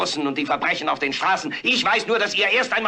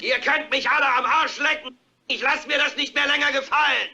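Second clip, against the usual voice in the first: defiant yell; 6 semitones higher than usual. Acted. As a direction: yell it from the open window. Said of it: "steckt den Kopf raus und schreit".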